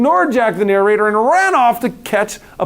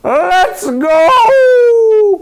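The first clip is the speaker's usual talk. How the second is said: high, loud crow like a rooster; 12 semitones higher, 7 dB louder than usual. A narrator's reading. Rooster agreeing to go, loud crowing call. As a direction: crow it out like a cockerel. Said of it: "crowed Cocky Locky".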